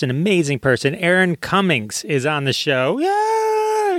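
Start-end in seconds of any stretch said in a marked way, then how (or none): 3.0-4.0 a sudden whoop, much higher; excited whoop. Real, not acted. Introducing his guest Erin, a sudden excited whoop. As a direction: cheer the guest in with a yelp.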